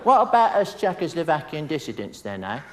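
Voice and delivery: mock Cockney film star line; hammed-up Cockney swagger; Cockney Michael Caine. Comic quoting a film star's line in mock Cockney. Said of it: "he delivered a line".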